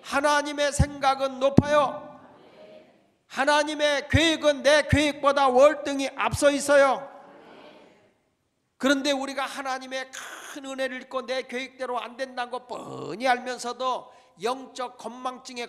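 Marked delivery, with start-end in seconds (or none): 0.0-12.0 loud, ringing sermon delivery; preaching. Acted. Pastor exhorting his congregation, preaching at full force. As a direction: preach it out to the whole church.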